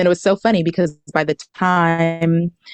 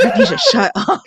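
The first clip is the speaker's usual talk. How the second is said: much higher, laughing through the words; laughing shut up. Retold or imitated. Telling her friend to stop distracting her, laughing high.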